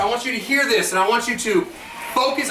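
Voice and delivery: normal talking voice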